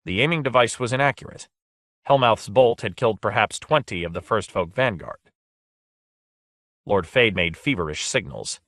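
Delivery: ordinary delivery, nothing marked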